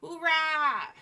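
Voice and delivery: high, loud hooray; cheering. Real, not acted. Cheer at done build.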